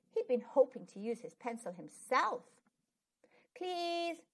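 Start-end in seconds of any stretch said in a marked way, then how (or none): 3.5-4.2 put-on high pleading children's chorus; begging. A narrator's reading. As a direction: plead like eager kids. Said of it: "begged Billy and Ben and Asif".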